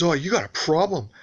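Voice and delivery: imitating the neighbor's sneering taunt; mocking neighbor. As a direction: throw it back at him like a challenge. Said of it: "He goes".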